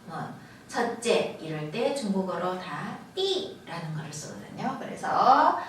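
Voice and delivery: ordinary delivery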